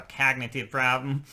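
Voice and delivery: put-on a light Boston accent, cognitive said as kahg-na-tiv; playing a tough Boston guy asking about a problem. Hosts joking about a Boston accent, a light Boston accent. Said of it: "that's how Boston people say it".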